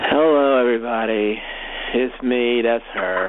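low, drained and listless; tired. Shown as a demonstration. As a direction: sound worn out and low. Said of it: "that's what it sounds like if somebody's, like, tired".

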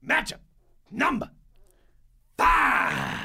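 big booming segment announcement; said with your chest. Acted. Co-host announcing the segment title, booming and drawn out. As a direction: bellow it like a ring announcer. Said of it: "that's how you say it with your chest".

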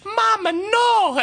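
Trina's Mama no. Retold or imitated. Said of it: "And she says".

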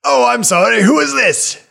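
put-on high, loud, delighted old man; overjoyed to meet an old friend; excited old healer. NPC voice.

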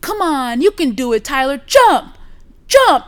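high, loud cheering-on yell; imitated yelling mother. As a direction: imitate a mom hollering encouragement, building to a shout. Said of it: "she's yelling".

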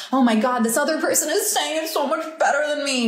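mildly hammed-up comparison panic; mock self-doubt. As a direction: fret as an insecure inner voice. Voices self-doubting inner comparison.

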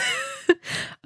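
shrieking giggle way up high; high squeal of laughter. Real, not acted. Mother laughing at her daughter's joke, high shrieking giggle. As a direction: let a high laugh burst out.

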